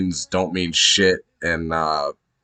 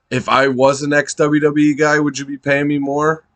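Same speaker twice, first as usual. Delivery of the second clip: mock promo yell; 6 semitones higher than usual. Fan retelling MJF's demand to Tony, mock yelled. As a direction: punch it like a wrestler's promo.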